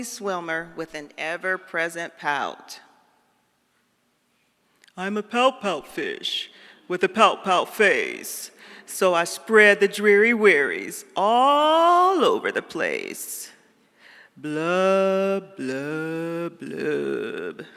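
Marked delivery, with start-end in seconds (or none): 5.0-13.5 slow, droopy pout-fish voice; glum fish refrain. Acted. Reading the fish's sad refrain to listeners, slow and droopy. 14.4-17.6 falling, fading blubs; fish blub. Acted. The pout fish ending his refrain with sinking blubs.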